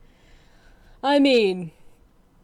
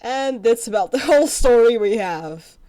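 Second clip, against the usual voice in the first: laughing; 4 semitones higher, 8 dB louder than usual. Real, not acted. Laughing at game story.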